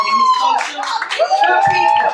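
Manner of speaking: squeaky high imitation; mock high voice. Comic quoting a man's praise, squeaky high mock voice. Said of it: "He's like".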